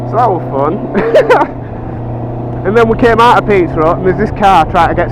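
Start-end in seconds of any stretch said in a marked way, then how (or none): 0.8-2.6 squeaky breathless giggle; high laugh. Real, not acted. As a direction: let a high laugh slip out. Laughing after the story.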